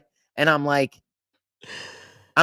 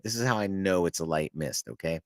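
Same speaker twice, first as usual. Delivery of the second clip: normal talking voice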